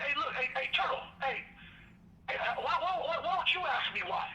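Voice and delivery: retold irritation, pitched high; agitated call. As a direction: push back at him, fed up. Narrator confronting guard across tier.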